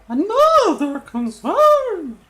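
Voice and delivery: high, loud and slow, stretched out; high mock voice. Shown as a demonstration. Showing viewers how her voice went up, high and loud. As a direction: replay the voice crack on purpose. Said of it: "ooh, my voice went up there, did you hear that?".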